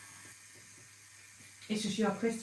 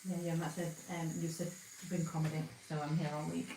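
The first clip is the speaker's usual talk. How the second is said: flat, softer, holding back a laugh; monotone; 3 semitones lower, 9 dB quieter than usual. Real, not acted. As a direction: keep it monotone to hide laughing. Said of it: "so I'm speaking monotone now".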